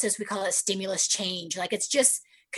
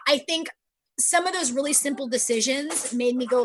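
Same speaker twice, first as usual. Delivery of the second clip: ordinary talk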